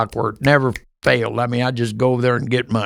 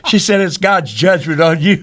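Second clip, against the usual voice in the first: laughing through the punchline; amused, chuckling on the words; 4 semitones higher than usual. Real, not acted. Delivering the cat punchline to the host, laughing.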